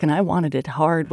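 usual speaking voice

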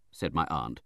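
plain reading voice

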